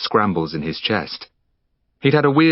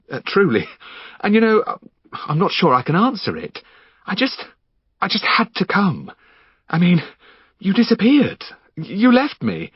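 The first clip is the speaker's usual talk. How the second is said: much higher, giddy unhinged voice, laughing; manic Henry; 9 semitones higher than usual. Acted. Henry pinning Finn down, manic and giddy. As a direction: manic intruder mocking himself.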